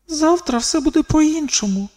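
високий відсторонений внутрішній голос; подумай це вголос тонким голосом; думка Каліші. A narrator's reading. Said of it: "подумала Каліша". (високий голос думки дівчини)

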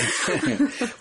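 short high-pitched chuckle; brief amused laugh. Real, not acted. Warm joking goodbye after the interview.

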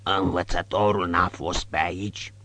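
pale thug asking the innkeeper; hoarse voice. Acted. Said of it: "cu o voce răgușită".

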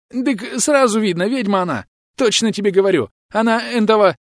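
high, frantic country bumpkin voice; high panicky peasant. Acted. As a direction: gabble like a scared yokel. Frightened bandit justifies himself. (высокий испуганный голос мужика)

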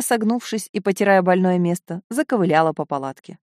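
ordinary delivery, nothing marked

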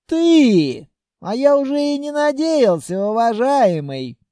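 high, loud, excited greeting; delighted surprise. A narrator's reading. Merchant greets the long-awaited visitor, high excited welcome.

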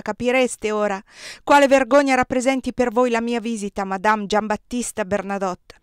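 loud, cutting reproach; angry scolding. A narrator's reading. The emperor berating Désirée, loud and angry. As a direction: hammer the shame into her.

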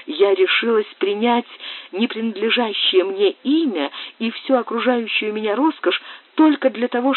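высокий голос девушки в мольбе; молить о пощаде, голос выше и напряжённее; в отчаянии. Acted. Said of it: "вскричала с отчаянием Сюзанна". (Сюзанна умоляет Бриана не осуждать её, с отчаянием)

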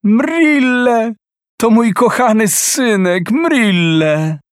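high and loud happy cry; joyful shout. Acted. Mother joyfully shouts to her returning son at full voice. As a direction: call him home with all your joy. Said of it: "zawołała radośnie na cały głos".

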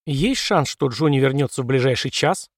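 plain reading voice

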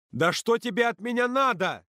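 high, loud exasperated yell; unable to hold back, shouting. A narrator's reading. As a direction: yell as if patience has snapped. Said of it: "не в состоянии сдерживаться, закричал он".

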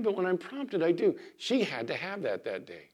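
ordinary talk

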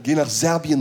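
normal talking voice

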